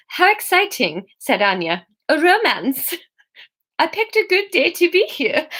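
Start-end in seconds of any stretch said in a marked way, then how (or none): 0.1-1.1 put-on high-pitched botched Swedish accent; bad Swedish accent. Narrator voices Anya reacting to romance, bad Swedish accent. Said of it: "This is the worst accent yet". 2.1-5.5 put-on higher, botched Swedish accent with a giggle; bad Swedish accent. Narrator voices Anya welcoming romance, bad Swedish accent. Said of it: "This is the worst accent yet".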